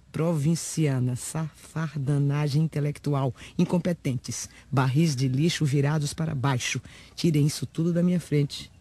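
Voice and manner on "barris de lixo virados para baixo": ordinary talk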